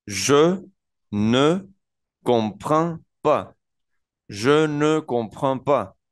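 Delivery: slow, careful French "Je ne comprends pas"; slow French demo. Shown as a demonstration. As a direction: model "Je ne comprends pas" slowly, syllable by syllable. ("Je ne comprends pas" despacio)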